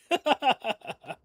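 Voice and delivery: sudden high-pitched giggle; high laugh. Real, not acted. Streamer laughing at own tease, high giggle. Laugh after teasing viewers.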